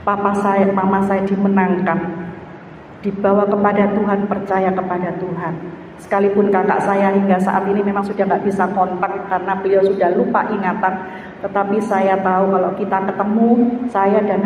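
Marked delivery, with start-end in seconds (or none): none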